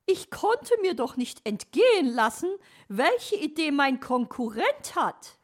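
loud, very high bird-lady voice; cheeky magpie. Acted. Magpie admits spying on her rival, high cheeky voice.

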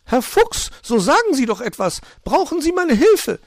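very high, loud, excited magpie; agitated calling. A narrator's reading. Magpie pressing the fox to answer, calling agitatedly. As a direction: call out in a fluster, way up high. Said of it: "rief die Elster aufgeregt".

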